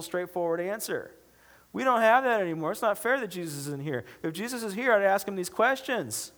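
high-pitched, hammed-up whiny complaint; whining. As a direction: moan to your wife about how unfair it is. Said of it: "I'm whining to my wife".

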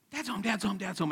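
put-on high, excited children's chant; excited kids. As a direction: imitate little kids thrilled that dad's home. Kids excitedly greeting dad from window.

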